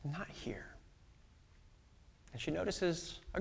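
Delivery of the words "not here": breathy whisper of loss; barely voiced, the body is gone; whispered grief. Mary finds the tomb empty.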